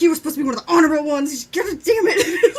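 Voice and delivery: mock tirade cracking into laughter; imitated angry yelling. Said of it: "he's, like, yelling the whole time".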